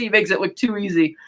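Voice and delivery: ordinary talk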